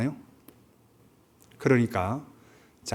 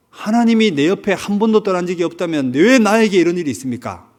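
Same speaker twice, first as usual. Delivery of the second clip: louder, higher, demanding why; defiant loud retort; 6 semitones higher, 7 dB louder than usual. Retold or imitated. Hero shouts back at God, indignant. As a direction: hurl the question back at God. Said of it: "도리어 반문해요".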